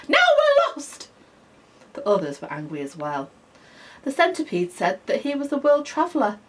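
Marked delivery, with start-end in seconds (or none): 0.1-1.1 high, loud furious bark; barking grasshopper. A narrator's reading. Grasshopper blaming the centipede, loud furious bark. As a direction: bark it in fury. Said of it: "he barked".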